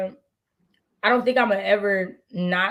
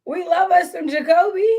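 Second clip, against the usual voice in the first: sing-song fan gush; 9 semitones higher, 3 dB louder than usual. Pretend. Repeated fan declaration.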